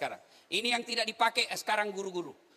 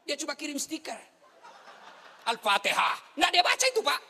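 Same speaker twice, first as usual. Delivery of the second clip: mocking; 9 semitones higher, 6 dB louder than usual. Mocking lazy WhatsApp mourners.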